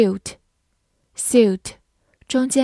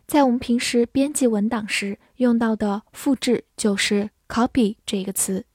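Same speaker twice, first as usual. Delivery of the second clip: usual speaking voice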